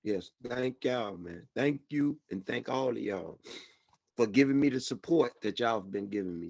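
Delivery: tearful thanks. Real, not acted. Thanks supporters after crying.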